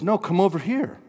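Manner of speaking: high, loud beckoning call; competing voice. Acted. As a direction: call them over insistently. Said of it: "another voice says".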